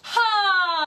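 high, loud "Ha!" blasted from the diaphragm; blast of breath. Shown as a demonstration. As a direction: push a sudden flood of air through it. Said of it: "gives it a bit of a blast".

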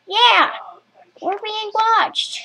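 ordinary talk

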